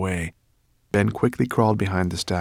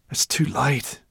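moaning; 8 semitones higher than usual. Acted. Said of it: "Sorrel moaned".

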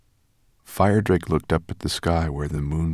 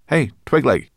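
high, loud call; 8 semitones higher, 7 dB louder than usual. A narrator's reading. Ben calls Twigleg out of the backpack, loud and bright. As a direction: call him out cheerfully.

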